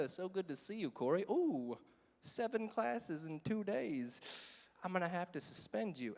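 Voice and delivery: soft, lightly chuckling principal voice; gloating principal. Retold or imitated. Principal suspending a student he laughs at, softly gloating. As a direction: imitate a quietly amused principal, drawing out the charge. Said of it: "he laughs at me".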